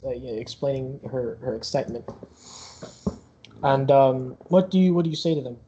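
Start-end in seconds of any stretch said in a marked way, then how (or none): none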